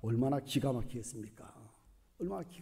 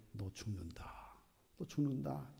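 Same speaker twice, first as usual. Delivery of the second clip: very soft voicing of a death sentence; hushed pronouncement; 2 semitones lower, 13 dB quieter than usual. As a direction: drop the voice right down, grave verdict. God's death sentence on Moses.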